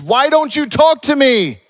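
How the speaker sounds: high, exasperated shout; loud demanding. Acted. Pilate to a silent Jesus, loud demanding. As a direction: demand an answer at full voice, pitch soaring. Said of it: "Pilate demanded".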